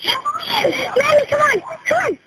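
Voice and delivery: very high, tearful cry for mother; panicked calling out. Real, not acted. Child begging mommy to come away from fire, panicked cry. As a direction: plead in a high crying shout.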